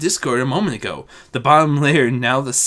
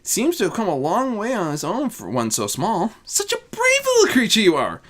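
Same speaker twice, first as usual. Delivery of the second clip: put-on higher, cooing baby talk; motherly; 8 semitones higher than usual. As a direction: praise the little creature tenderly. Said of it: "motherly, mothering approach".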